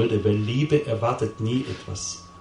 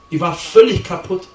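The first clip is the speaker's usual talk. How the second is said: emphatic outburst; 7 semitones higher, 7 dB louder than usual. Real, not acted. Climax of testimony.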